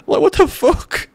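very high, slow disbelief; incredulous. Retold or imitated. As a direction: squeak out the disbelief. Disbelief at being treated like cartoon.

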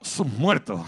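blunt Seville oath, short; Sevillian blunt curse. Retold or imitated. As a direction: spit it out like a local from Seville. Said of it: "Tú aquí en Sevilla... y dices". (a lo sevillano, seco)

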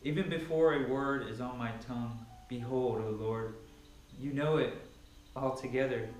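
fervent Bible reading swelling into preaching; scripture reading. Acted. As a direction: proclaim the psalm like a preacher building fervor. Reads Psalm 139 aloud.

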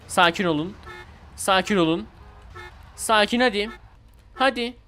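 ordinary talk, nothing marked

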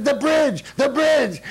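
very high, loud retold shout; exasperated yelling. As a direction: shout it twice as if they should know. Bandleader yelling the explanation back.

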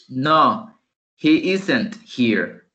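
very slow "isn't" with stress; slow model negative. Shown as a demonstration. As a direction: spell out "he isn't here" slowly. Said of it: "La otra forma". (negativa lenta y clara)

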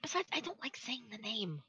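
soft uneasy whisper. Real, not acted. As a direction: breathe it out, barely voiced. Avoids saying the villain's name.

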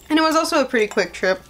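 talking between bites; muffled chewing speech; mouth full, chewing. Real, not acted. Seen 1: Bites noodles just before; jaw working, lips pressed while talking.

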